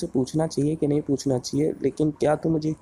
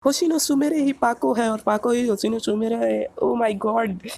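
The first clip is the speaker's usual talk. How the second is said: high-pitched, stunned realisation; amazed disbelief; 10 semitones higher than usual. Acted. Fan discovers his partner is his idol, amazed disbelief. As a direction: marvel at an astonishing reveal. Mitsu learns Pako is Sumire.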